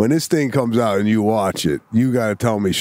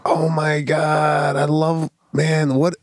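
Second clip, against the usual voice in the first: higher, drawn-out mock gush; imitating gushing sister; 5 semitones higher, slower than usual. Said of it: "my sister goes".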